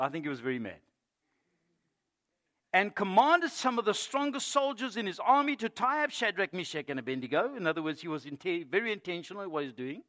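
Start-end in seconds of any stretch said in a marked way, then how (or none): 2.7-7.5 high, loud pulpit reading; forceful scripture reading. Real, not acted. Preacher reading how soldiers were commanded, forceful scripture reading. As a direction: proclaim the king's command.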